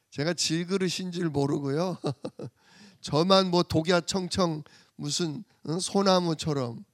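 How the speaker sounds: ordinary talk, nothing marked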